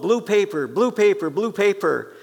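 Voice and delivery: imitating the artist calling for his wife. Painter calling to his wife in the next room, a called-out request. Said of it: "the artist would call out".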